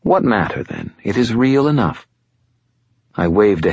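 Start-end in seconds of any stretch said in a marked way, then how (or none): 0.0-2.1 higher, silky voice of an ancient fallen angel, calm and superior; fallen angel Lashiel. Acted. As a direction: speak as a patient otherworldly being, a little above it all. Lashiel speaks.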